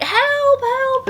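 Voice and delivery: very high hammed-up squeal; mock cry for help. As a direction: squeak out help like a panicked little guy. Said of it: "he's like".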